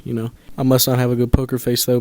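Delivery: ordinary delivery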